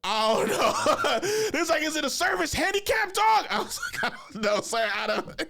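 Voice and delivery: laughing helplessly through it; can barely get it out for laughing; giggling I don't know. Retold or imitated. Laughing through retold reply.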